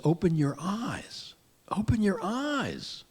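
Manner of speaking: high, drawn-out donkey voice; talking donkey. Acted. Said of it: "The donkey turns around and says".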